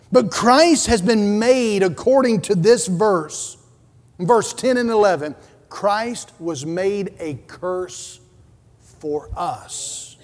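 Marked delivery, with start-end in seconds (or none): 5.7-10.2 slow and hushed, trailing off; grave near-whisper; solemn slow hush. Acted. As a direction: let it sink in, soft and slow. Solemn climax of sermon.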